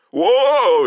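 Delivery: loud high startled yelp; startled cry. Acted. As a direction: yelp as the horse rears. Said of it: "he cried".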